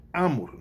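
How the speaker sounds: amor said as A-mor, first syllable high; reversed stress on amor, AH-mor; stress moved to first syllable. Shown as a demonstration. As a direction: hit the A of amor and let mor fall. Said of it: "Se eu invertesse ela".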